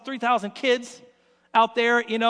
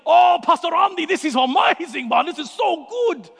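loud, gushing praise of the cookie; excited interpreter; 4 semitones higher, 4 dB louder than usual. Retold or imitated. Interpreter Harry gushing to Pastor Andy, loud and delighted. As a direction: ham it up as the thrilled helper. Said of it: "And he's like".

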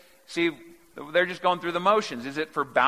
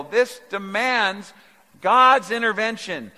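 higher and louder, slowing on the key words; preaching at full force; 7 semitones higher, 5 dB louder than usual. Acted. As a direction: build the urgency like a pulpit climax.

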